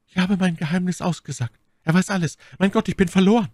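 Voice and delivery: high, wailing despair; despairing Milady. Acted. Milady has betrayed her secret and is lost, despairing. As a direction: build to a stronger cry of being lost.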